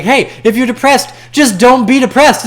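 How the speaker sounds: high, loud mocking singsong of a know-it-all; mocking quote. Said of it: "All those people like".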